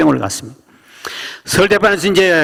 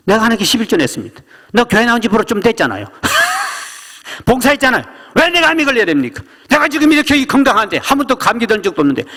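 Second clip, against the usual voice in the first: 높은 목소리로 억울하게 따지며; 억울함을 쏟아내듯 높게 호소; 원망하는 집사; 6 semitones higher than usual. Retold or imitated. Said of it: "원망을 쏟아내기". (하나님께 원망을 쏟아내는 집사의 높은 하소연)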